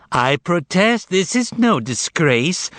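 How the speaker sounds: high, slow indignant yell. A narrator's reading. The cat objecting to the master, yelling. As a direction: yell a drawn-out protest. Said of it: "yelled the cat".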